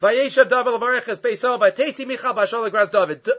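ordinary delivery

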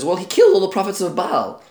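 high, loud eager telling; bursting to share the news; excited boast. Retold or imitated. Achav bursting to tell Izebel the news, high and loud.

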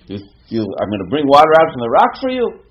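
acted exasperation rising to a high, loud shout; angry outburst. Moshe angrily offering the people water from the rock, acted shout. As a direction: build from a stammer to a furious peak. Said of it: "Moshe Rabbeinu got angry".

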